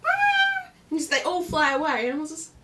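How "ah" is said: high, loud little bird squawk; tiny bird screeching to scare the others off. Retold or imitated. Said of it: "just goes".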